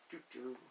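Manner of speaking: soft hummed doo-doo jingle. Shown as a demonstration. Teacher mimicking a video game console, soft hummed doo-doo. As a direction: hum it quietly like a game sound.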